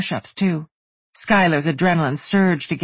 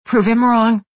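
higher, louder urging; urgent encouragement; 7 semitones higher, 6 dB louder than usual. A narrator's reading. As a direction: push her hard. Friend urging her on.

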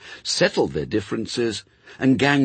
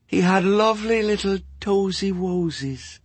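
a lightly voiced entranced woman, higher and cooing; dreamy ex-nun; 11 semitones higher than usual. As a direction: coo it like a fond memory. Dazed ex-nun voice.

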